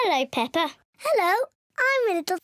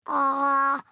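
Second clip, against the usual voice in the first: a long open "Ah" at a lower pitch; open-mouthed ah for the nurse; 5 semitones lower than usual. Pretend. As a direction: open wide and say "Ah" like at the doctor's. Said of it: "Open wide and say".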